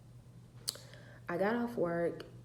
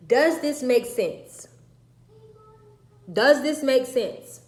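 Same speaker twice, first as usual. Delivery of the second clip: loud angry shouting, higher; furious yelling; 4 semitones higher, 10 dB louder than usual. Retold or imitated. Mother yelling at her daughter in the car, furious. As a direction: yell it at her, fed up. Mom yelling about grandmother.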